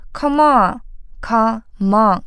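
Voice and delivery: "Come on" run together as one word; linked come on. Shown as a demonstration. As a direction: glide "come" straight into "on". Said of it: "Come和on连读成come on". (把come和on连读)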